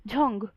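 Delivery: झंग with breathy aspirated j; Korean jung. Shown as a demonstration. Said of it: "जंग नहीं या जुंग नहीं". (झंग को कोरियन अंदाज़ में बोलो)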